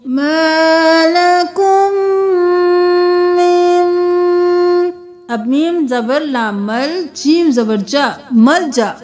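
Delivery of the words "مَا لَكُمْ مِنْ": slow melodic tajweed chant; Quranic recitation. Shown as a demonstration. Teacher chanting the verse for students, slow tajweed. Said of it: "ادھر سے پڑھیے".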